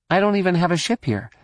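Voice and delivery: fast, whiny complaint; complaining. A narrator's reading. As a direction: grumble it quickly. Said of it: "Jaina complained".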